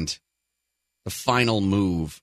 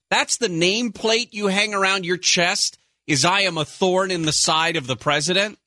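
pitched up, sarcastic proud boast; mock the self-important title, higher; 8 semitones higher than usual. Mocking self-billing as a thorn.

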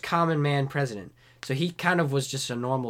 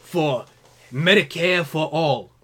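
slow, loud rallying politician; Bernie Sanders impression; 2 semitones higher, 5 dB louder, slower than usual. Senator pledging to fight, slow and loud. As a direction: hammer each word slowly. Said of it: "I'll do a good Bernie Sanders".

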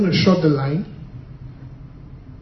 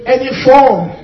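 loud declaration; 6 dB louder than usual. Shown as a demonstration. Preacher repeats the declaration, loud and firm. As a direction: declare it again with force. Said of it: "One more time, say".

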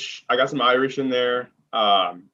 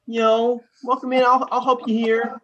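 pitched-up helpful clerk voice; customer service voice; 11 semitones higher than usual. Retold or imitated. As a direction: welcome the customer with care. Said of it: "that care".

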